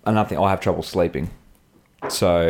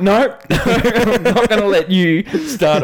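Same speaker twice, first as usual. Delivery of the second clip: laughing refusal; 13 semitones higher, 5 dB louder, much slower than usual. Retold or imitated. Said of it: "I'm like".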